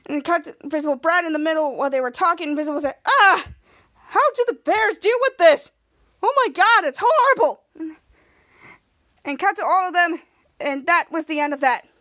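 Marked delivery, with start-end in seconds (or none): 3.1-7.6 high, loud hammed-up complaint; mock agony. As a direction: wail it like an overdramatic victim. Retold Brad agonizing over bear spray.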